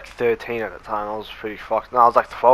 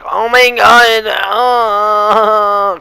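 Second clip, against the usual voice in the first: high, loud, drawn-out scandalised voice; mock shocked gasp; 10 semitones higher, 8 dB louder, much slower than usual. Classmates reacting to the rumour, high loud shocked gasp. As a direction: gasp it like the whole year heard gossip. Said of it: "everyone was like".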